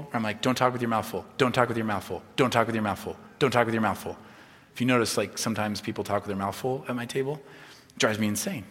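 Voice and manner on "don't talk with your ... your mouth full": low-pitched, repeated parental scolding; nagging parent. Retold or imitated. Parent at the dinner table correcting children, repetitive nagging. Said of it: "I'm that way as a parent".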